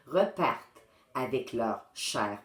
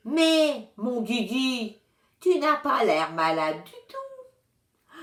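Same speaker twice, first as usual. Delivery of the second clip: put-on slow, gentle, higher old woman's voice; calm the child down kindly; lightly voiced grandmotherly reassurance; soothing old lady; 8 semitones higher, 5 dB louder, slower than usual. A narrator's reading. Madame Rose soothes the boy.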